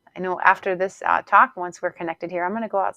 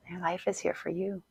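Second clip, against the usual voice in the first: softer echo; 8 dB quieter than usual. Shown as a demonstration. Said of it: "And your life is here for you".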